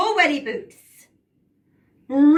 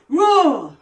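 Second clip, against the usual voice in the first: building shouts of roar, higher each time; shouted roar; 4 semitones higher, 5 dB louder, much slower than usual. Shown as a demonstration. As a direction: roar louder and louder. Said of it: "we're gonna shout roar five times".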